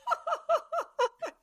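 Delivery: high burst of laughter; real laughter; big laugh. Real, not acted. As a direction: laugh at them. Laughs at the singing.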